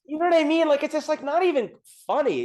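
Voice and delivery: exasperated disbelief. Retold or imitated. Mocking lazy song lyrics.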